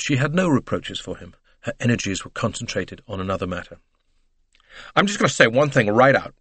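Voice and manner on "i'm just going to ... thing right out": slightly breathless, higher-pitched declaration; agitated American lady, a touch breathless. A narrator's reading. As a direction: announce it in a fluster. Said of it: "she said breathlessly".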